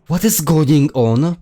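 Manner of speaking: alarmed shout. Acted. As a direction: cry out in fear. Frightened outburst in scene.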